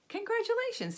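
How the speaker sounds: very high, excited congratulations; delighted congratulations. A narrator's reading. Teacher congratulates Amelia's promotion.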